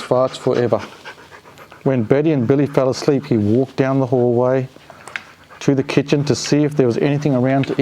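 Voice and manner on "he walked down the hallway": ordinary delivery, nothing marked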